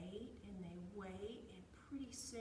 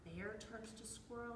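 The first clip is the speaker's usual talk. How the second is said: plain reading voice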